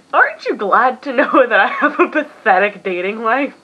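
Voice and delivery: laughing. Real, not acted. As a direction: laugh at your own misery. Laughing at herself.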